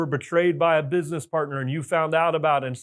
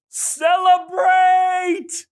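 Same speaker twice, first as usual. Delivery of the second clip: long drawn-out shout, much higher and louder; shouted celebrate; 13 semitones higher, 5 dB louder, much slower than usual. Real, not acted. Speaker rallying the audience, drawn-out joyful shout. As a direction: belt it out slowly like a rally cry.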